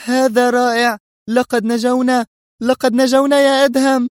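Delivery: high, loud woman's cry of relief; overjoyed, high-pitched exclamation; disbelieving joy. A narrator's reading. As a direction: cry out as if you can't believe you survived. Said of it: "وصاحت منى غير مصدقة نجاتها".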